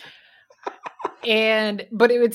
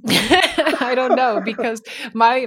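laughing; 3 semitones higher, 7 dB louder, slower than usual. Real, not acted. Laughing at own punchline.

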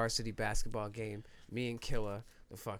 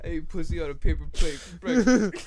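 loud bro boast, much louder; frat bro; 7 semitones higher, 14 dB louder than usual. Acted. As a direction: shout it like a frat bro bragging. Frat bro brag, shouted.

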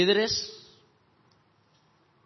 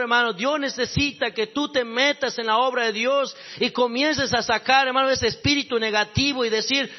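loud, raised sermon voice pressing the congregation; fiery preaching; 8 semitones higher, 7 dB louder than usual. Acted. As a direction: preach it out at full force, rising and urging. Preacher builds to full force.

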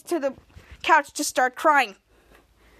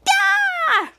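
very high mock scream; frustrated scream; 4 dB louder than usual. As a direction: let out a shrieked, play-acted scream of frustration. Frustrated scream after hang-up.

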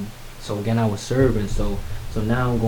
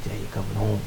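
soft, understated imitation of crying; hushed, mildly choked-up grandma; retold tearful news; 2 semitones lower, 7 dB quieter than usual. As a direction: break the news quietly, lightly tearful. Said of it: "she's like, she's crying".

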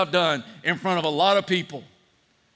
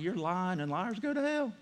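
much softer, under your breath; muttered retort; 11 dB quieter than usual. Retold or imitated. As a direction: mutter it like a private thought. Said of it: "I'm like".

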